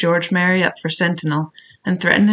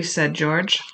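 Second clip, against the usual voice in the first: ordinary delivery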